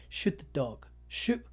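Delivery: a quiet British order to shoot; British accent. Retold or imitated. A trainer tells the recruit in British accent. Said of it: "they do it in their British accent".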